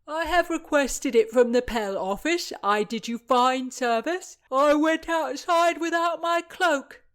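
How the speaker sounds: loud, high whining clerk; self-pitying clerk. Acted. The lazy clerk whines to the pages about his errand, loud and high.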